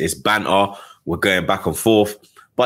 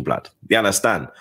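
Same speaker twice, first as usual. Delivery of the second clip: ordinary delivery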